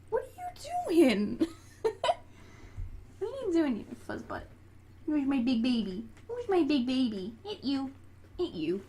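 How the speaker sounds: high-pitched playful cooing to a pet. Real, not acted. Cooing to a pet, baby talk.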